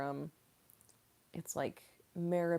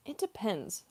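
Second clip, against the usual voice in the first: normal talking voice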